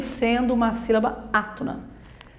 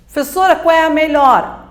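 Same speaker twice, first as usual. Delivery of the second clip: higher, louder imitated student asking the teacher; put-on student voice, pitched up; student's question; 5 semitones higher, 5 dB louder than usual. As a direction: mimic a pupil piping up with a question.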